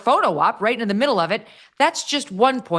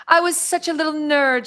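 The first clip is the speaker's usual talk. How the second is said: high, prissy mocking quote; mock Meghan voice; 9 semitones higher than usual. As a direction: lightly mimic a self-satisfied celebrity.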